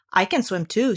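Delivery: ordinary delivery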